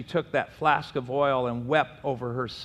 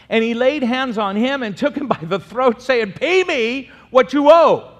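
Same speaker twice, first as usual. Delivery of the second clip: angry demand; 10 semitones higher, 11 dB louder than usual. Acted. Cruel servant choking his debtor, angrily demanding. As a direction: seize him and demand your money.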